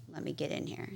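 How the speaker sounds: whispered under her breath; whispered plea. Retold or imitated. To herself before seeing a doctor, whispered plea. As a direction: murmur it to yourself, desperate. Pleading inner thought, retold.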